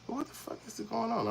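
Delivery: stunned and lost in a crowd; high incredulous aside; disbelief. Retold or imitated. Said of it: "And I'm thinking, like".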